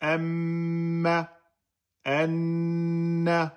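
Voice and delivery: M and N hummed, each held for two beats; held nasal two beats. Shown as a demonstration. Tajwid teacher showing the class, M and N held two beats. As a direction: hum the M and the N long and steady. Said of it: "vous restez deux temps".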